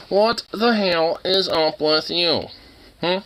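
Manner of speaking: slow, drawn-out exasperated question; exasperated emphasis. Real, not acted. Repeats question, slowly drawn out.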